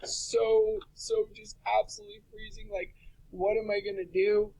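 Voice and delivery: high, breathy, panicked inner voice; a hammed-up, freezing, frightened whine; mock panic. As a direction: fret it out in a high squeak. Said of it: "So I'm thinking".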